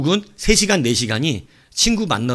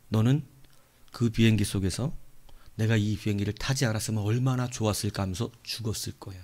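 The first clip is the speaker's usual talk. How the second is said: soft, lower, solemn revelation; voice of God; 5 semitones lower, 7 dB quieter than usual. Retold or imitated. God revealing to the traveller the plane was broken, hushed solemn voice. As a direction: reveal it quietly, pausing between phrases. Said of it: "그 순간 하나님이 주신 거예요".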